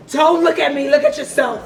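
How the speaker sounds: loud, high, laughing retort; playful comeback. Pretend. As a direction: throw it out laughing, as a rehearsed zinger. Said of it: "I'ma hit him with that shit".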